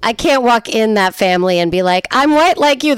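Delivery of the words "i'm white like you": pleading newcomer. Acted. Voicing herself addressing that family.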